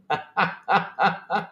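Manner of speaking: staccato chuckle in even bursts; laugh. Real, not acted. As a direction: laugh in quick repeated beats. Amused by viewer chat.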